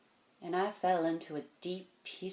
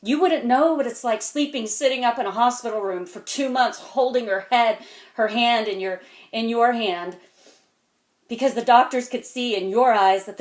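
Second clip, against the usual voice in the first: loud, raised voice, driving on; impassioned outpouring; 3 semitones higher, 10 dB louder than usual. Acted. Man recalling hospital nights to a kid, loud and impassioned. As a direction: let it pour out forcefully at full voice. Heated speech about wife's illness.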